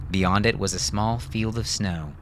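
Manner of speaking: plain reading voice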